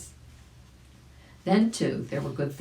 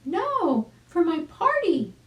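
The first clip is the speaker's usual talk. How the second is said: much higher, delighted chick voice; exclaiming chick; 14 semitones higher, 5 dB louder, slower than usual. Acted. Arabella greeting Uncle Wiggily, high delighted chick voice. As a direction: squeal with joy at seeing him. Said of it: "answered Arabella".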